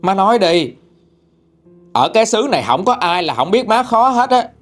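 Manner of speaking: high-pitched, fed-up son; scolding. A narrator's reading. Son confronts his difficult mother over driving everyone away, scolding. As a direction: throw it back at her, voice pitched well up. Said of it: "Ba chồng em mới chửi bà nội".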